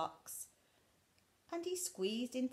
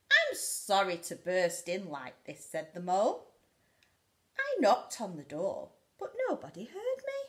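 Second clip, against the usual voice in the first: loud, eager little mole; lightly voiced apologetic mole; mole's apology; 2 semitones higher, 11 dB louder than usual. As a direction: burst out the apology.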